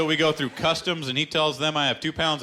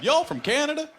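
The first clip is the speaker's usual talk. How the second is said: Texan bartender; 12 semitones higher than usual. Retold or imitated. Comic imitating a Texas bartender asking him. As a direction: ham up the clueless bartender. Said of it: "He said".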